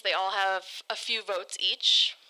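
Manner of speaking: ordinary talk, nothing marked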